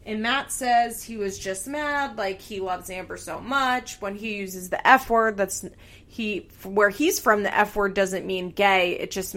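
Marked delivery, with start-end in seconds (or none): none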